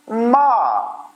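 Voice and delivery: माँ started high, dropped sharply, very slow; falling tone demo. Shown as a demonstration. Teacher showing students the fourth tone, high falling. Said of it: "माँ का मतलब भी mother है".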